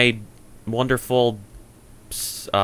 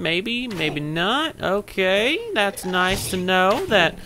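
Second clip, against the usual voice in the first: put-on voice; 5 semitones higher than usual. Player talking to creepers about to kill them, silly put-on voice. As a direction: swoop up high on stretched-out words, mock-pleasant. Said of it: "I don't know why I'm using this voice".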